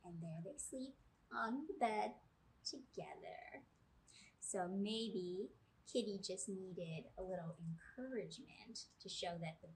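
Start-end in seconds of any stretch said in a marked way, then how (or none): 2.7-3.6 soft whispered ending. Acted. Teacher reading to children whispers so the sleeping cat won't wake.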